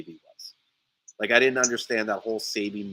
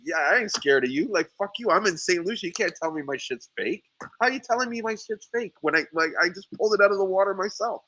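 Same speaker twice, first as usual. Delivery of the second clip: pitched-up, quick retold defiance; defiant comeback; 7 semitones higher, faster than usual. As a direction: act out standing up to a rival, voice climbing. Acted defiant reply to the rival.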